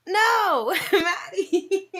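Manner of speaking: shrieking with laughter; laughing shout. Real, not acted. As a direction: squeal the name through laughter. Laughing outburst at chat.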